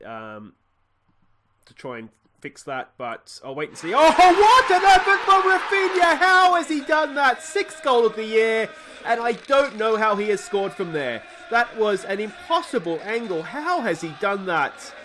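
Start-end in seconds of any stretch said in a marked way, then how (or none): 3.9-8.7 high, very loud commentator scream; screaming goal call, much higher and louder; ecstatic goal shout. Real, not acted. 9.1-14.9 higher, louder, amazed; thrilled disbelief at the goal; excited commentary. Real, not acted. Still celebrating the goal.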